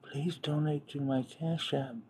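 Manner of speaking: breathy near-whisper plea; soft mock whisper of Channing; imitating Channing. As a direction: hush it like a coy plea. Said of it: "Let me do her voice".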